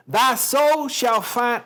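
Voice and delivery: high, loud Southern declaiming; grand, drawn-out Southern poem reading; Southern accent. Shown as a demonstration. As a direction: recite slowly in a big Southern drawl. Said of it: "a Southern accent".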